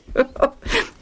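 short high chuckle. A narrator's reading. Lucius laughs at himself, a brief chuckle. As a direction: chuckle lightly at the memory. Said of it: "Lucius chuckled as he thought of it".

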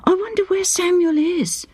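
a wife struck by a hopeful thought; wife wondering aloud if Samuel comes home, excited; sudden excitement. A narrator's reading.

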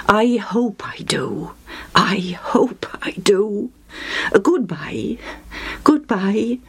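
slow hushed whisper; whispered hope. A narrator's reading. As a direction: breathe it as a private wish. Sidon man whispers.

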